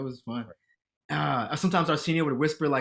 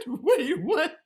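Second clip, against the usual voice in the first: higher startled wait, what; mock double take, high; surprised asking on set, higher; 12 semitones higher than usual. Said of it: "I said".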